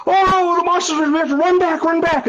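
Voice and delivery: high, hammed-up mock panic; panicked tour guide. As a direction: herd them away in feigned terror. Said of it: "then the tour guide, me".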